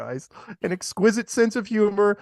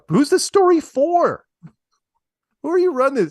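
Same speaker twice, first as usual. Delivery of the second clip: mock disbelief; 12 semitones higher, 6 dB louder than usual. Real, not acted. Mocking the news story.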